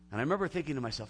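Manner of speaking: normal talking voice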